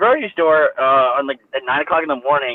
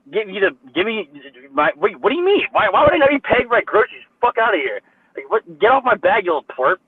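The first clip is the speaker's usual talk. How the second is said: higher, indignant retort at the staff; retold angry outburst; 5 semitones higher than usual. Retelling telling off grocery staff, indignant yell. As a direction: snap back, outraged that they think you're stealing. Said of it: "I'm like".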